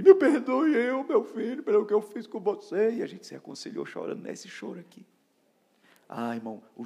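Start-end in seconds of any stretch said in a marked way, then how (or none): 0.0-2.9 high, loud, slow crying plea; tearful apology. Retold or imitated. The old pastor asking his disciple's forgiveness, crying. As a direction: beg forgiveness through tears.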